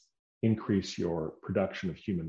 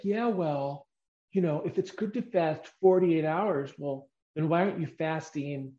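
lightly voiced, higher-pitched objector; sceptical viewer; 8 semitones higher than usual. Viewer pushing back on fasting length, lightly mocked high voice. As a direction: challenge it like a doubting viewer, pitched up.

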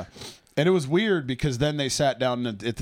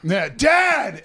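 high, loud shout with laughter in it; imitated calling out; 9 semitones higher, 6 dB louder than usual. Co-host imitating a kid calling to Dad in line, loud shout.

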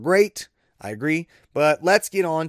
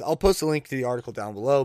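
usual speaking voice

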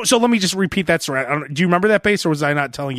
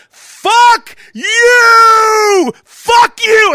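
screamed high and loud, voice cracking; furious shout; 10 dB louder, much slower than usual. Retold or imitated. The checkmate moment on the caller, a screamed high curse. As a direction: yell it at full force. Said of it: "And then I hung up".

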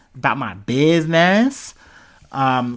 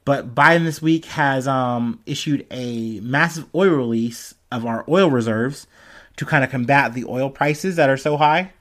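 usual speaking voice

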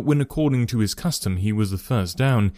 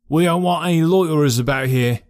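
raised, rough stable groom warning them off; gruff groom; 5 semitones higher, 4 dB louder than usual. A narrator's reading. Groom warning strangers away from the stables, higher gruff voice. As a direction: bark it like a surly groom. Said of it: "said he".

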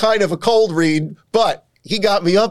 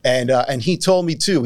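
ordinary talk

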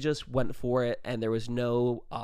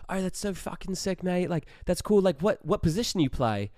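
higher, excited mock Australian; Australian accent; 6 semitones higher than usual. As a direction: imitate a keen Australian lad. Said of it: "these kids were also Australian".

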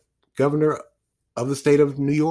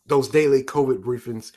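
ordinary talk

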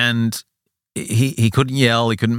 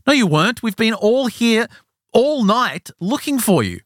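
very high-pitched indignant complaint; acted-out fed-up friend, pitched way up; exasperated scolding; 13 semitones higher than usual. One of the boys telling off the father after searching, exasperated.

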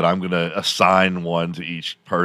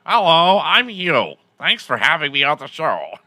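much higher, louder stiff computer voice; whitest Microsoft guy; 8 semitones higher, 3 dB louder than usual. Retold or imitated. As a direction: imitate a canned Microsoft text-to-speech guy. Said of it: "the whitest Microsoft guy".

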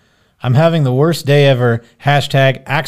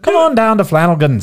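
higher-pitched Irish accent; 6 semitones higher than usual. Shown as a demonstration. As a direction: put on an Irish lilt, pitched up. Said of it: "can you do an Irish accent?".

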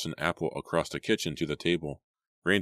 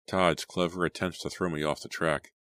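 plain reading voice

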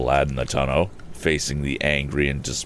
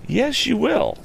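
insisting; slower than usual. Acted. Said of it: "insisted our hero".

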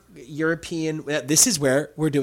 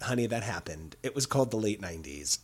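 lower, much softer quoted reply; dry correction; 5 semitones lower, 9 dB quieter than usual. Retold or imitated. Host quotes friend correcting them, dry quiet correction. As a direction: drop low and quiet to set someone straight. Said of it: "Kevin was like".

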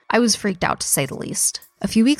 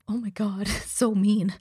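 soft laughing under the breath, much quieter; laughing aside; 3 semitones higher, 5 dB quieter than usual. Real, not acted. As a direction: giggle it out quietly to yourself.

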